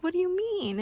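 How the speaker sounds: a startled, high what-do-you-mean; surprised. Retold or imitated. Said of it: "And I was like".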